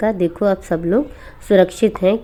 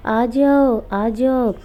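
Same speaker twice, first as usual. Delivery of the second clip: higher, slow, drawn-out witch call at the door; a witch calling sweetly at the door at night, higher and slow; 4 semitones higher, much slower than usual. Acted. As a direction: lure him out with a sing-song witch call. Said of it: "तो वो आवाज़ लगाने लगती है".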